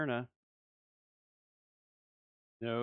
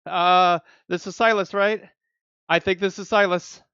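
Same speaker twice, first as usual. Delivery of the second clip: breathless disbelief; 7 semitones higher, 15 dB louder than usual. Real, not acted. Unsure who was pulled.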